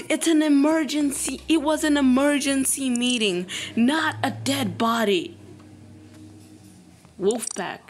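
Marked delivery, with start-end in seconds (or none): 0.1-5.4 louder, higher insistent protest; exasperated protest. Real, not acted. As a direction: argue your case. Defensive protest at vote.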